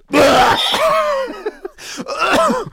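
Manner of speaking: harsh coughing fit from chili; coughing and gasping on spice; choking cough. Real, not acted. Coughing after a super-hot chili gummy.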